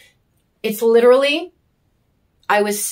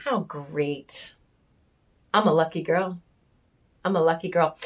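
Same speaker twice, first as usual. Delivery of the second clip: dry sarcasm; 3 semitones lower, 7 dB quieter than usual. Real, not acted. Sarcastic soft aside.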